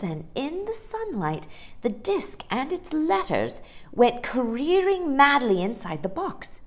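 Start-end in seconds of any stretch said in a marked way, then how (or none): none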